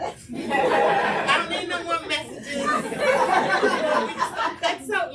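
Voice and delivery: loud, high, laughing through the words; real laughter breaking into speech. Real, not acted. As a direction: crack up while saying it. Joking about no more messages.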